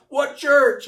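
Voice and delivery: loud, high snap of a question; gruff demand. Retold or imitated. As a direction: bark it at the visitors. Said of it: "He didn't know what the church was".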